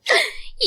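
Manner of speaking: high unvoiced startled gasp; mother-in-law gasping as the daughter-in-law faints; sharp gasp. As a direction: catch your breath in fright. She collapses, mother-in-law alarmed.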